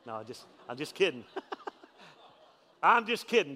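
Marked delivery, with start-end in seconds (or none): 2.8-3.6 louder, higher, laughing disclaimer. Real, not acted. Pastor teasing about his wife to the congregation, laughing. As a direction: take the joke back through a laugh.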